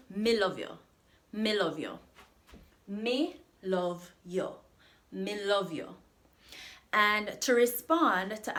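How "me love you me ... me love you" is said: teacher modelling "Me love you" in Jamaican patois; Jamaican patois demo. Shown as a demonstration. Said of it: "I love you" in Jamaican patois is".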